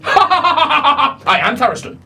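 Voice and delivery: high, slow, laughing boast; grand laughing reveal; laughing proclamation. Acted. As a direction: laugh it out like a mad god. God laughs and declares self.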